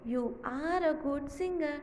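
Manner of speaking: very slow, word by word; slow revision reading; slow rereading. Shown as a demonstration. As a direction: reread slowly with the class. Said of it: "तो".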